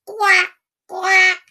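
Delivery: duck quack. A narrator's reading. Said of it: "gave a loud".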